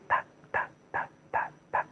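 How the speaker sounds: tiny whispered ticks, spaced apart; soft tongue-tap patter; imitated raindrops. Teller showing rain starting drop by drop, soft ticks.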